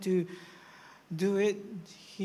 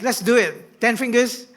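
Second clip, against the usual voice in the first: louder, higher rallying call; rally the crowd to join; preacher rousing the congregation; 6 semitones higher, 9 dB louder than usual. Real, not acted. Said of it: "shout it out".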